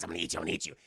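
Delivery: hissed menacing whisper; creepy whispered threat; monster whisper. Retold or imitated. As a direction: breathe it out like a monster. Monster speaks to janitor.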